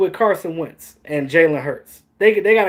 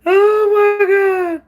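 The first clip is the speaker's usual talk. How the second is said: laughing disbelief; 5 dB louder, much slower than usual. Real, not acted. Cracking up at the quote.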